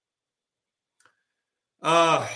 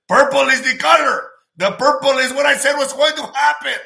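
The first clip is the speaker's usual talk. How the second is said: excited; 8 semitones higher, 7 dB louder than usual. Real, not acted. Excited purple claim.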